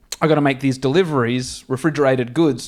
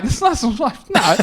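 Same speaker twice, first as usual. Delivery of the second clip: higher, giggling denial; laughing; 8 semitones higher than usual. Retold or imitated. Answering the bystander about the truck, laughing. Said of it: "I'm laughing".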